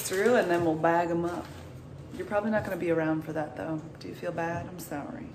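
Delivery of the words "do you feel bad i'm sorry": ordinary talk, nothing marked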